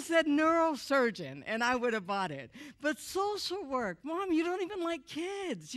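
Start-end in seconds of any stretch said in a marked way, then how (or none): 2.8-5.7 slow, drawn-out disbelieving social work; incredulous repeat. Retold or imitated. Son repeating his mother's answer to the crowd, slow and incredulous. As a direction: let the words hang in disbelief. Mocking mom's choice.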